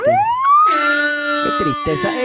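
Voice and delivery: agudísimo y muy lento, lamento de burla; tristeza exagerada. Pretend. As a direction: estira el lamento como un llanto fingido. Lamento exagerado tras el chiste. (falsete lento y teatral de pena fingida)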